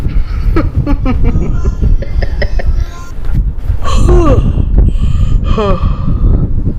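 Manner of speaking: giggling laugh; can't hold back a giggle. Real, not acted. Laughing at the phone game.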